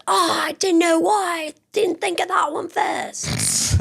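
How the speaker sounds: high-pitched teasing imitation of a partner; hammed-up, high kicking-yourself voice; mock regretful partner. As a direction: whine it high, mocking their regret. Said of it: "you're gonna be like".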